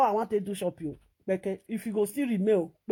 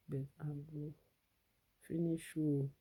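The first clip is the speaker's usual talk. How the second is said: much lower and softer threat, "finish you" murmured; a hushed, much softer "I go finish you"; 6 semitones lower, 13 dB quieter than usual. Shown as a demonstration. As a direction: mutter "finish you" low and soft. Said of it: "Then I go finish you".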